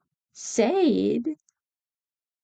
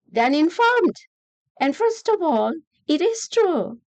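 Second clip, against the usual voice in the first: indignant; 8 semitones higher, 3 dB louder than usual. Real, not acted. Angry rant against media.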